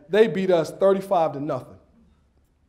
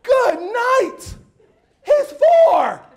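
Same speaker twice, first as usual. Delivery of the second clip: high, loud comic outrage; explosive high-pitched shout; exasperated yell; 7 dB louder, much slower than usual. Acted. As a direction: holler it in disbelief at the crowd. Exasperated outburst at audience.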